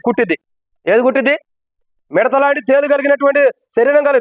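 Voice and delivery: usual speaking voice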